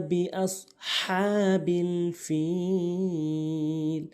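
slow, melodic tajweed chant; Quran recitation. Shown as a demonstration. Teacher reciting the first verse for learners, measured Quran recitation. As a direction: recite with long, held vowels.

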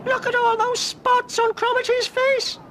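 cried out. Acted. Ross crying out at Cromarty's spots, squeaky. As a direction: yelp in shock like a cartoon boy. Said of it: "cried Ross".

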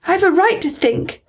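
a touch of sharp protest; sharp retort. A narrator's reading. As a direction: answer back sharply, pitch up. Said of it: "said Alice sharply".

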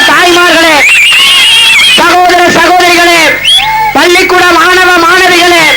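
rising, ringing rally address building to shouts; thunderous political oratory. Real, not acted. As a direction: hail the crowd like a stage orator. Rousing greeting to the crowd. (முழங்கும் அரசியல் மேடைப் பேச்சு)

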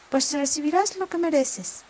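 amenaza aguda y fuerte; castígalo con rabia; amenaza furiosa. Acted. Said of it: "gritó la zorra". (la zorra amenaza a su rabo, aguda y enfadada)

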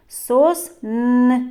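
"Сосни" with a held, repeated "н"; sounding out N. Shown as a demonstration. Teacher isolating the next sound, "Сосни" with lingering "н". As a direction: pause after "Сос", then dwell on the "н" of "Сосни". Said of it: "Звук н".

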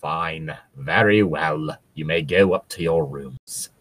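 low, slow, measured drawl; deep, slow stern-professor voice; Professor Snape. Acted. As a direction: grant it reluctantly, deep and deliberate. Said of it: "Professor Snape said".

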